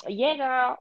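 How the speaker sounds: lightly imitating a coworker's put-on voice; imitated voice change. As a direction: slip briefly into the coworker's affected voice. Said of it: "voice, this change".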